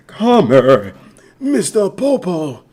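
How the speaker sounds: put-on voice stretching the name; character voice. Streamer calling Mister Popo, drawn-out anime character voice. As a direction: beckon in character, drawing out the name.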